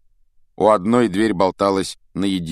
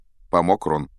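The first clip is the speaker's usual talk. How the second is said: usual speaking voice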